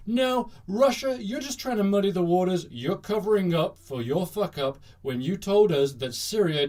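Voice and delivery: hammed-up accusation; mock indignant rebuke. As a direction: scold them like an accusing official. Acted rebuke from Trump administration.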